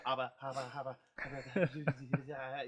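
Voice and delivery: loud mock stammer, dragging out into laughter; hammed-up tongue-tied gibbering; starstruck babbling. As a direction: babble as if too starstruck for words. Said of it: "just total starstruck".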